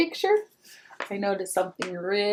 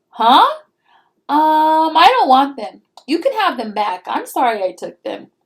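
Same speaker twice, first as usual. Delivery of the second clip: put-on loud, higher startled kid; flustered brother; 6 semitones higher, 9 dB louder than usual. Peter is caught stealing coins, loud flustered kid. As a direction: back down quickly, caught out.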